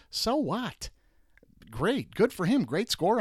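high mock praise; sarcastic brush-off. Real, not acted. Host mocking the stat with fake praise, high and sarcastic. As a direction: toss off fake congratulations.

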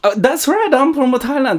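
a hammed-up Thai accent, claiming to be from Thailand. Host answers a cohost's guess in a put-on Thai accent. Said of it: "That's what I think a Thai people sound like".

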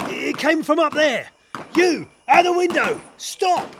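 loud, high shouted challenge; urgent shouting. Acted. Officer shouting at a gunman in a window, urgent. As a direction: yell up at someone far away.

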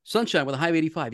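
quick, conversational forecast; just talking. Acted. Broadcaster told to just talk to his boss, quick conversational delivery. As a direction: toss it off as if chatting to one person. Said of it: "now you're just talking to me".

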